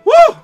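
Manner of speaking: high loud startled yelp. Real, not acted. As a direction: yelp from sudden fright. Jump scare in game.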